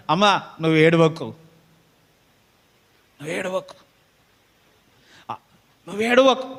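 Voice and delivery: tender comfort. Acted. Jesus comforting the mother.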